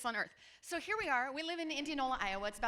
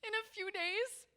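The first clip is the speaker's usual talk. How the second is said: put-on much higher, slow tearful child voice; sad little girl; 7 semitones higher, slower than usual. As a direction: mourn it like a heartbroken kid. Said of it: "She says".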